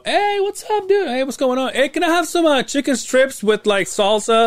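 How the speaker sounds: way up high, chirpy imitation of a buddy; high mock greeting. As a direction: ham up an over-excited hello, high and bright. Mimicking the friend's loud greetings.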